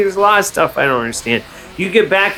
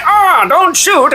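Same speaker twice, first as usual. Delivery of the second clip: mock plea; 13 semitones higher, 4 dB louder, much slower than usual. Victim begging before being shot.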